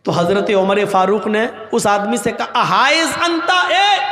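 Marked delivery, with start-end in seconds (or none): 2.5-4.1 shouting after him. Retold or imitated. Umar calls the man.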